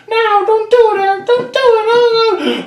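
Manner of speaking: high squeaky begging voice; high-pitched pleading little victim; pleading level voice. Acted. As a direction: beg for mercy in a tiny high voice. Level begs player not to.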